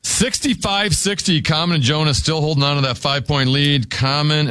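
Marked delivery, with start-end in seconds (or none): none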